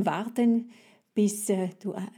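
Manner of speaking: ordinary delivery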